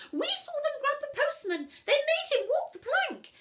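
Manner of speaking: very high, loud twittery ladies; gossiping old sisters. Acted. The Miss Yateses telling passers-by, shrill gossip. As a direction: twitter in shocked excitement.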